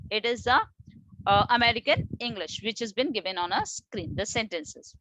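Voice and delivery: ordinary delivery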